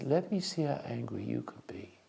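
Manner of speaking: whispered dare, barely voiced; taunting whisper. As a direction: breathe the challenge softly at him. Daring an angry man to hit.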